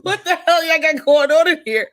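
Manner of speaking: high and loud, mock outrage; shocked outburst. Podcaster voicing her shock at the episode, high and loud. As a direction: demand an answer in shock.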